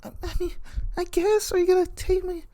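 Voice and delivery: very high, soft and hesitant; shy, giggly agreement; Cassandra's girly voice. Retold or imitated. Cassandra hesitantly agreeing to Rudy, high and shy. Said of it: "Cassandra would be like".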